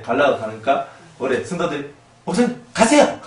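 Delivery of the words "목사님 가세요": 쫓아내는 성도. Acted. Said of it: "이렇게 된다는 뜻이에요".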